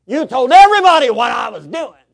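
very loud, very high accusing shout. Retold or imitated. Enraged man accusing the preacher, full shout. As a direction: scream the accusation. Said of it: "he went off again".

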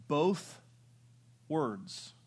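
ordinary talk

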